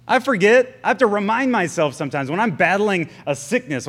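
ordinary talk, nothing marked

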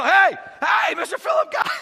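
high, loud shout for someone gone; calling out. Retold or imitated. Eunuch calling after the vanished Philip, high loud shout. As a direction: call out across the water.

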